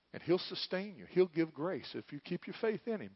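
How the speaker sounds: ordinary talk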